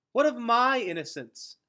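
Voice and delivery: high, loud, tense challenge; tight voice. A narrator's reading. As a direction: push back hard, voice tight. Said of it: "her voice tight".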